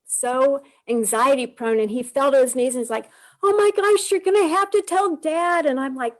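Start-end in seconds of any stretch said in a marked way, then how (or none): 3.4-5.7 high, louder mock panic from her son; imitating the son's frantic plea; panicked son. Son on his knees begging, mock panicked. Anxious son voiced in story.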